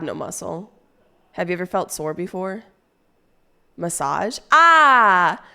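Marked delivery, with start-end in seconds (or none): 4.5-5.4 high, loud drawn-out "ah"; long, raised shout of delight; loud exclamation. Real, not acted. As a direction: cry out in sudden realisation. Reacts to the word massage.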